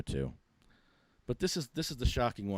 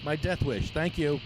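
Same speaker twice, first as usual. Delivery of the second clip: sarcastic; 6 semitones higher than usual. Acted. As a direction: parrot the cop with bitter sarcasm. Mocking the cop's orders.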